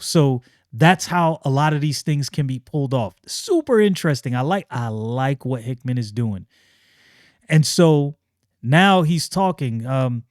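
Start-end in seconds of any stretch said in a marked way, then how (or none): none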